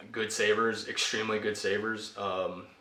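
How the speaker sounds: ordinary talk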